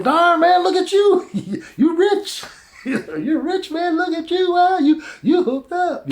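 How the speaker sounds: very high, laughing mock amazement; awed admiration. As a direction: gush over him, half laughing. Brothers admire rich Joseph.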